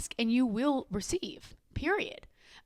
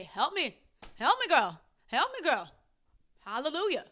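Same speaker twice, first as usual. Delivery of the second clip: higher, sassy call for help, a touch of gospel; sassy prayer; 5 semitones higher than usual. Retold or imitated. Vlogger voicing her prayer to her higher power, sassy and higher. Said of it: "I turn to that thing and I say".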